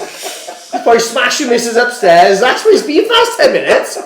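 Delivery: loud, rising, high banter breaking into laughter; laughing tease. Real, not acted. Mocking a friend who broke the chair, loud laughing tease. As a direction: rib him hard, cracking up.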